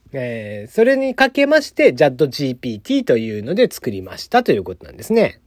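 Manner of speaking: ordinary talk, nothing marked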